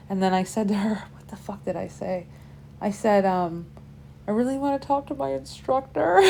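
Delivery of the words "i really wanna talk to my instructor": sheepish plea. Retold or imitated. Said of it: "I have no idea. It was so embarrassing".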